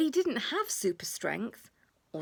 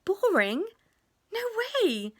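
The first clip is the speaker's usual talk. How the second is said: much higher, louder disbelieving "Boring?"; mock surprise; 11 semitones higher, 4 dB louder than usual. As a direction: playfully scoff at the idea. Mummy counters William's complaint.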